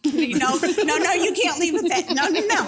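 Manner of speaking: mock bartender refusal. Bartender stopping a customer leaving, laughing mock no. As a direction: chase the patron off with a laughing no.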